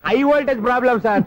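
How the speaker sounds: high-pitched irritated blurt; loud blurt. Real, not acted. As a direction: say it sharply, raising the pitch. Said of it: "ఆ మాట మెల్లగా కూడా చెప్పొచ్చయ్యా". (విసుగ్గా గట్టిగా చెప్పడం)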